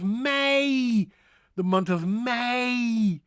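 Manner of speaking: high, stretched May with ei glide; exaggerated vowel in May; pronunciation demo. Shown as a demonstration. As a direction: draw out May slowly. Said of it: "Not mooi".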